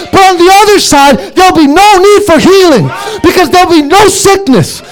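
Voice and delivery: high, driving sermon delivery; full-force preaching. Acted. Preacher to the congregation on gifts that will cease, impassioned preaching. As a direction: preach it insistently, in a high sustained pitch.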